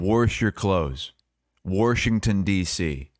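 "Wash" and "Washington" with an R, as "warsh" and "warshington"; drawn-out examples, an R slipped into "Wash" and "Washington"; imitating grandpa's "warsh". Son-in-law giving examples of grandpa's speech, "Wash" said as "warsh". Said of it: "because he says "Warsh".

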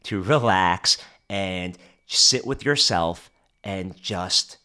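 slow, low, calming delivery; slowing the voice to calm listeners; talking slowly. Shown as a demonstration. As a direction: ease down and let it settle. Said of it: "as I'm talking slowly".

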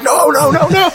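high squealing panic, no no no; panicked high no. Acted. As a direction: shriek the no's up high like a horrified condom. Quagmire condom joke, guy reacts.

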